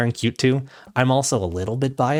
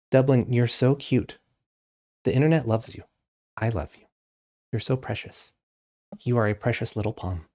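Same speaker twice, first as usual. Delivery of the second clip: tender to the dog. Real, not acted. Talking softly to her dog.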